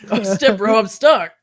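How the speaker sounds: hammed-up dumb jock calling out. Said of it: "my fucking jock voice".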